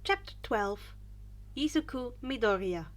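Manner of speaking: usual speaking voice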